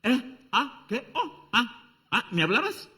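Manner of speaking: high, dazed "what?"; the son suddenly noticing his mom; startled teen. Acted. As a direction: pull off the headphones, confused. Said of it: "Y hace esto".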